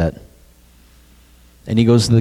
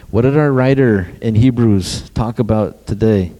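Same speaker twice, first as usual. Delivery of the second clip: ordinary delivery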